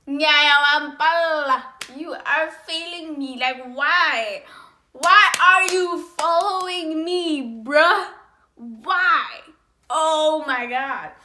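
loud, stretched-out exasperation; exasperated outburst. Acted. To the ex who follows her, exasperated. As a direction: hammer out each drawn-out complaint loudly.

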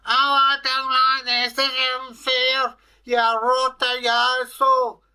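very high, loud, wailing creature voice; protesting Kelpie. A narrator's reading. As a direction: wail it out as a put-upon water horse.

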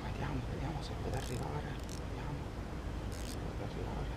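very soft near-whisper; hushed voice. Real, not acted. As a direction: whisper while reeling it in. Hushed while reeling in.

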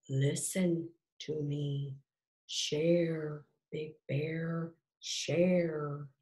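slow, soft voice of a big tree. A narrator's reading. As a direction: intone it softly and slowly, well spaced. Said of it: "A deep voice".

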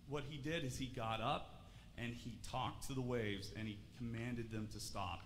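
usual speaking voice

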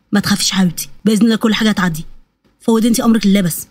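low-pitched, calming voice; steady comfort for a frightened wife; reassuring husband. Acted. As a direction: settle her fear in a low voice.

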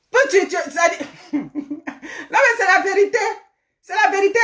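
high-pitched, talking through laughter; laughing. Real, not acted. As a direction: let the laugh break into the words. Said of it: "On a ri un peu". (en riant, voix aiguë)

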